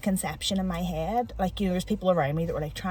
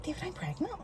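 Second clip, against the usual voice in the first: high, soft and hesitant "David, I'm pregnant"; small, stunned confession; 8 semitones higher, 10 dB quieter, faster than usual. Retold or imitated. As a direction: say it quietly, still in shock. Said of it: "lifted the phone and was like".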